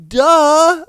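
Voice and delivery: loud, high-pitched mocking duh; exaggerated dismissive retort; mock obviousness. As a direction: punch it up as if blatantly obvious. Mocks the cheater's dismissive answer.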